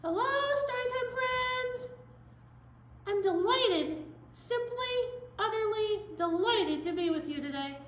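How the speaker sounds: high, sung, delighted puppet; a prim, gushing little elephant. Pretend. Puppet Elephant greets the children, high and gushing.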